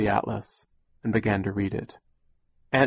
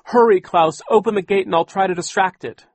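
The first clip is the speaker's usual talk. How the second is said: loud, high, urgent call to her brother; urgent shout; 10 semitones higher, 7 dB louder than usual. Acted. Violet yelling to Klaus to hurry with the gate. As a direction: shout the warning so Klaus moves now.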